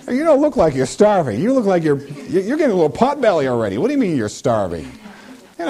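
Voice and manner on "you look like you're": ordinary talk, nothing marked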